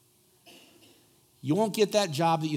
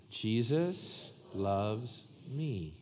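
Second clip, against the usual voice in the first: very slow, soft recital; 2 semitones lower, 6 dB quieter, much slower than usual. Shown as a demonstration. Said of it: "Let's do it again".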